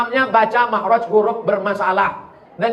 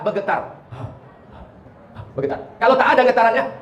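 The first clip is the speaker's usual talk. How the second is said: normal talking voice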